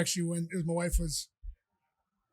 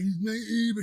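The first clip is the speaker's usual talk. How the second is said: lightly imitated drunk woman, higher and louder; mock drunk woman; 4 semitones higher, 6 dB louder, slower than usual. Drunk woman insisting the ride is hers, mock slurred voice. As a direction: put on a whiny drunk complaint, lightly. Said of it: "She talked worse than I did".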